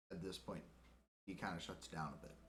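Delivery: normal talking voice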